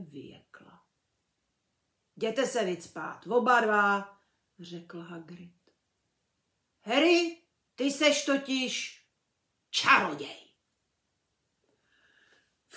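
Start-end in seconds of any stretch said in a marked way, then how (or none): 2.2-4.2 big booming giant, loud and rough-hearted; hearty half-giant, loud and emphatic; Hagrid's voice. A narrator's reading. Hagrid dismisses the Dursleys. 6.8-10.5 big booming giant, loud and rough-hearted; hearty half-giant, loud and emphatic; Hagrid's voice. A narrator's reading. Hagrid reveals the truth.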